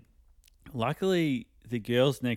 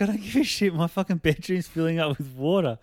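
high, loud frustrated outburst; inner rant; 5 semitones higher, 4 dB louder than usual. Retold or imitated. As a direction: blurt out the panic. Inner rant about flooding.

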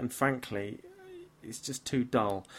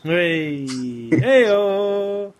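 high, loud celebratory hey-o; loud cheer conceding a good jab; 6 semitones higher, 9 dB louder than usual. Real, not acted. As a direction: whoop it like a crowd cheer. Host concedes a jab from Beastie.

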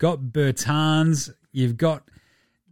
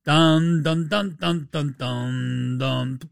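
mock dramatic sting; much slower than usual. Dramatic sting for bad news.

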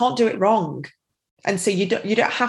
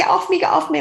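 put-on tantruming child; 10 semitones higher, much faster than usual. Daughter in a tantrum fends off mum's tapping, high mock protest. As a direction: imitate an upset child pushing someone away. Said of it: "she was having the tantrum and crying".

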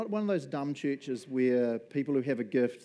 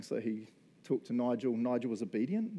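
normal talking voice

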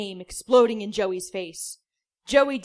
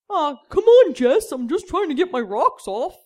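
much higher, loud cartoonish whine; a silly comic pleading with his friend; whiny goofball voice; 6 semitones higher, 8 dB louder than usual. [name] whining to Jesse.

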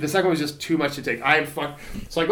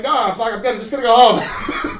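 louder and higher, laughing it off; laughing refusal; 7 semitones higher, 7 dB louder than usual. Retold or imitated. To paramedics insisting on hospital, laughing. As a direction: brush off the medics through laughter.

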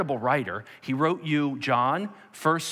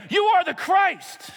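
high, slow, shouted declaration; loud ringing proclamation, drawn out; decisive proclamation; 6 dB louder, slower than usual. Acted. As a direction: shout it out with total conviction. Acted Peter's decisive answer.

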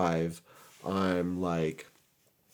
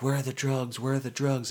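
higher-pitched, insistent mock cop; imitated questioning cops; 6 semitones higher than usual. Police searching the crashed car, imitated demanding questioning. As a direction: lightly act out a demanding officer.

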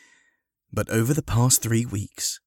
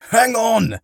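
high, loud shout to halt someone; urgent cry; 7 dB louder than usual. Acted. Harry to the departing paramedics, crying out. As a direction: shout to stop them leaving. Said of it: "cried Harry".

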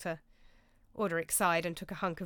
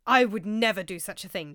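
louder, firm and emphatic; firm declaration; 8 dB louder than usual. A narrator's reading. Roger to the monks, emphatically declaring his innocence. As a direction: declare it like a vow. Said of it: "declared Roger".

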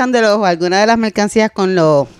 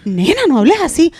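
scolding mom; 9 semitones higher than usual. Retold or imitated. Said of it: "mi mamá me dice".

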